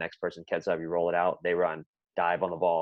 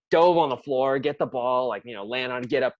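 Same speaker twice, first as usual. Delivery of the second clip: ordinary delivery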